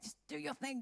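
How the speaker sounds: soft, hushed encouragement; quiet urging. Retold or imitated. Staff quietly telling Susie to go pray. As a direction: nudge her on quietly.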